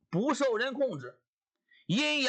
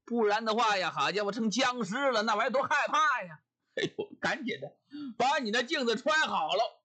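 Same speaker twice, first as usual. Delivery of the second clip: ordinary talk, nothing marked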